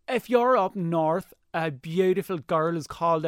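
slow, loud northern accent; Northern Irish accent. Shown as a demonstration. Showing the host his cartoon voices, Northern Irish accent. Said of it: "throw a few of the accents out there".

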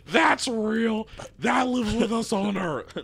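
high, loud exclaiming through laughter; laughing outrage. Real, not acted. Ranting to co-host about the movie, excited. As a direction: marvel loudly that this exists.

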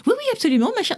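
very high, chirpy yes-yes; hammed-up high-pitched oui oui; mock eager reply. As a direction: play up the eager French student. Her own eager reply, performed.

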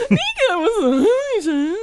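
high voice cracking with tears; voice breaking. Real, not acted. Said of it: "your voice is broken".

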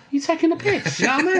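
high, squeaky mock disbelief; mock incredulous. Retold incredulous quote, very high.

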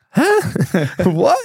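high squeaky shocked huh, laughing; reliving the disbelief at the call; stunned disbelief. Retold or imitated. Player hearing he's signed, squeaky stunned disbelief.

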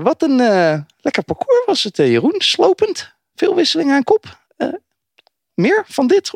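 squeaky, very high mock voice; chipmunk voice. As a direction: pitch way up like the sped clip. Host mimics sped-up clip voice.

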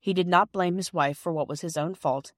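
ordinary delivery, nothing marked

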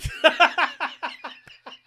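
loud, high burst of laughing; helpless laughter. Real, not acted. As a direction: crack up completely and keep laughing. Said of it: "I'm laughing because it's fucking stupid".

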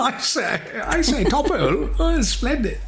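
high, hammed-up upper-class voice; mock posh toff. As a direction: play a jolly old English toff.

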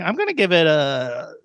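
ordinary talk

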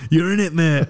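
cheeky local. Retold or imitated. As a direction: hand it back with a grin.

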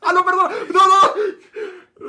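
high-pitched, very loud yelping apology; frantic loud backpedalling; panicked retraction. Real, not acted. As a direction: shriek as you take back a gaffe. Frantically retracting an accidental gaffe.

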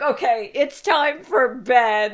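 high, tense, slow quit; nervous. Retold or imitated. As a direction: nervously end it. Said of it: "we were like".

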